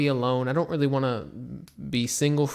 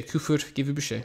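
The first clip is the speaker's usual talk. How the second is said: ordinary talk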